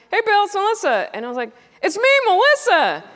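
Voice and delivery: very high, loud introduction; overeager greeting. Retold or imitated. Greeting Bill Burr in a dark party, high and loud. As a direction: announce yourself too keenly.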